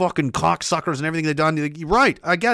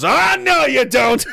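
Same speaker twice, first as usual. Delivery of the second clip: much higher, louder gleeful yell; imitating a shout; 8 semitones higher, 4 dB louder, slower than usual. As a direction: bellow it as you swing the board. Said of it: "Danny DeVito's guy comes by ... and he goes".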